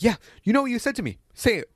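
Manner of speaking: high-pitched mock accusation; confronting administrator. Administrator orders student to repeat rude remark, mock confronting.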